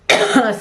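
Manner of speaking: short dry throat cough. Real, not acted. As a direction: clear a tickly throat. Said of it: "I have a tickle in my throat".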